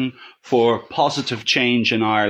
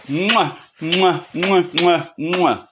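blowing kisses; 4 semitones higher, slower than usual. Real, not acted. Blowing kisses to end message.

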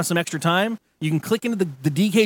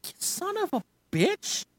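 mock exasperated; 13 semitones higher, 10 dB quieter, slower than usual. Phone rings unexpectedly.

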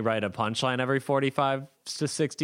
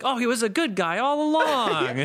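hammed-up high-pitched surprise; cartoon-ending reveal; 12 semitones higher than usual. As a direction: gush it like a cartoon's final unmasking. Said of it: "It's like the end of Scooby-Doo".

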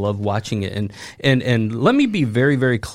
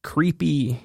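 ordinary talk, nothing marked

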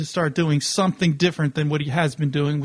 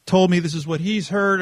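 ordinary delivery

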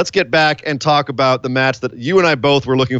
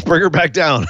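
laughing; 3 semitones higher than usual. Real, not acted. Laughing suggestion.

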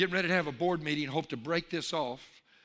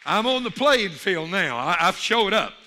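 loud, raised preacher's declaration; rousing shout; 3 semitones higher, 9 dB louder than usual. Real, not acted. Preacher declaring he has showed up, rousing shout. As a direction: proclaim it forcefully to the crowd.

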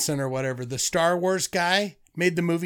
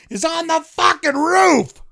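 high, loud, mock-horror climax; hyped-up plot reveal; 12 semitones higher, 5 dB louder than usual. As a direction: blast out the big reveal. Hyped-up reading of film plot.

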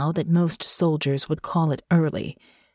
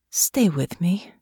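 gentle whisper; slower than usual. A narrator's reading. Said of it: "Natasha said gently".

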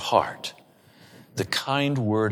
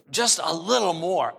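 lightly voiced dentist, higher, coaxing; 7 semitones higher than usual. Dentist to patient in the chair, lightly acted coaxing. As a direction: politely ask for a bit more, pitched up.

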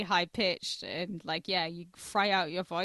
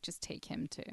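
ghostly whisper; 3 semitones lower, 13 dB quieter than usual. Retold or imitated. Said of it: "Lady Death was like".

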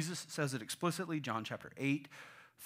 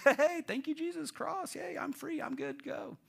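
high mock-glee, quick and light; carefree believer; 10 semitones higher than usual. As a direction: imitate someone gleefully off the hook. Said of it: "and be like".

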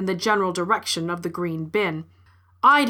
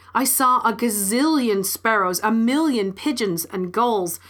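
ordinary delivery

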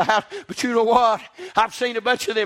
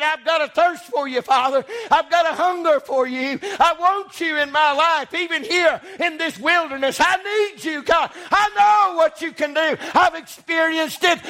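fervent prayer; 7 semitones higher than usual. Acted. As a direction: cry out to God with longing. David's prayer preached aloud.

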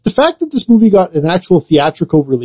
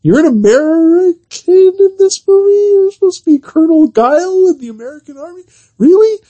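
mock disbelief; 12 semitones higher than usual. Mocking disbelief, much higher, ridiculing the casting to cohost. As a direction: sell the disbelief, high and climbing.

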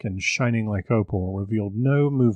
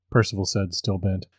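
ordinary delivery

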